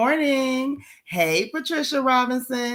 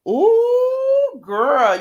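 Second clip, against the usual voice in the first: high, loud drawn-out "ooh"; excited whoop; 13 semitones higher, 7 dB louder than usual. Real, not acted. Host congratulating a viewer on her marriage, excited whoop. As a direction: whoop with delight for a friend's news.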